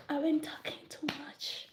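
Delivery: hushed aside, breathed out; self-scolding whisper. Real, not acted. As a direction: whisper it to yourself.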